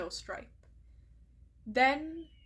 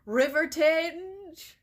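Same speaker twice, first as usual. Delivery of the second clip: slow, much louder call across camp; bellowing; 5 semitones higher, 7 dB louder, much slower than usual. Acted. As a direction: summon her across the whole camp. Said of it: "she bellowed across the camp".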